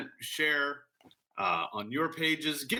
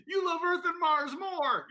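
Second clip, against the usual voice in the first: put-on whiny hurt child; 13 semitones higher than usual. Playing the rejected planet.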